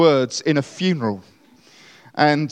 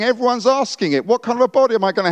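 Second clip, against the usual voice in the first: exasperated Paul; 8 semitones higher, faster than usual. Retold or imitated. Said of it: "and Paul's saying".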